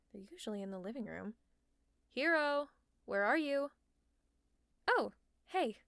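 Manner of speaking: usual speaking voice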